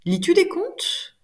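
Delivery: Lis-tu des contes? sliding up high, slow; rising question. Shown as a demonstration. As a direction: ask Lis-tu des contes? with pitch climbing to the end. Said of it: "elle est montante".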